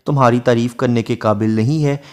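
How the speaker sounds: ordinary talk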